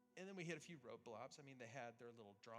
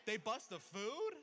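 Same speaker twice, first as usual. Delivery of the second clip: mock outrage; 8 semitones higher, 11 dB louder than usual. Pastor mocking the crowd's gasp, hammed-up outrage. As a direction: ham up the shock for the crowd.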